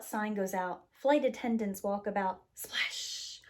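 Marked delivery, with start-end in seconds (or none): 2.5-3.4 soft breathy splash noise; whispered splash. Pretend. A cup tips over, soft whispered splash. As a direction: breathe it out like spilled water. Drink spilling.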